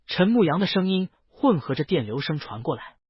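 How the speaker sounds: plain reading voice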